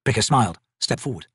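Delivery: usual speaking voice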